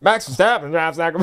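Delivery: put-on Australian accent gone Texan; badly faked Australian twang sliding into Texan; botched Australian accent. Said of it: "My Australian accent".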